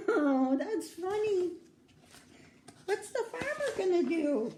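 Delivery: amused laugh. Real, not acted. Reader laughs at monkey.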